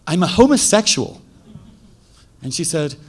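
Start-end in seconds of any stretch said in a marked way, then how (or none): 0.1-1.2 louder and higher, spelling it out for mother; emphatic repeat. Retold or imitated. Son repeating his coming out to his confused mother, emphatic and louder. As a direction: say it again, louder, so she gets it.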